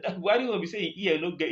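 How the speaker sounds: ordinary talk, nothing marked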